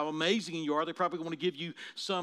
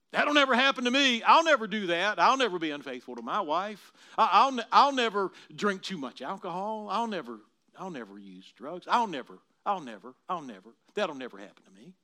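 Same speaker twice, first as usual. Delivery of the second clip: boastful Simon; 8 dB louder than usual. Acted. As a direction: play a cocky man sure he won't fall. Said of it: "Simon bristled at the warning".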